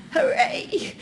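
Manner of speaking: high, playful hooray; mock cheer. Lecturer jokes about luck of being born in England, mock cheering. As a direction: cheer yourself with a little self-mocking hooray.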